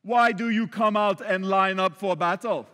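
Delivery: loud bad-guy Eastern European accent; hammed-up Eastern European warrior taunt. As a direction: shout a challenge as an Eastern European villain. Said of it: "Eastern European accent".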